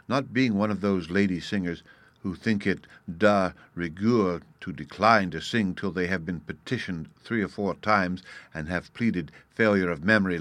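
ordinary delivery

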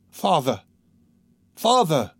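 put-on mildly distressed child's call; crying out. A narrator's reading. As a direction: call for father with rising urgency. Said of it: "he cried".

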